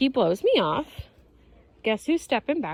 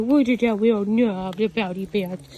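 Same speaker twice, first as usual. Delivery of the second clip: voicing the dog; 5 dB louder than usual. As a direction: play a dog babbling back, stretching the words. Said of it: "He's like".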